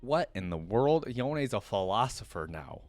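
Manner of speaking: ordinary talk, nothing marked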